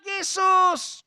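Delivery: high, loud, drawn-out call; preacher re-enacting a desperate shout; retold cry for help. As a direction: call out the name long and loud. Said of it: "Dia teriak".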